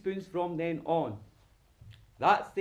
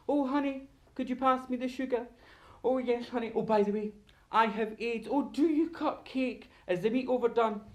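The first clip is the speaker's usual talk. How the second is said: high, airy domestic small talk; mock-cheerful couple's patter, pitched high; blasé chit-chat; 7 semitones higher than usual. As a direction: drop it casually amid dinner chatter.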